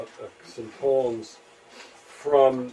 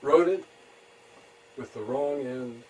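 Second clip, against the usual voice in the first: haiku reading; 3 semitones higher than usual. Acted. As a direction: let each phrase hang, then drop to a hush. Reads own haiku.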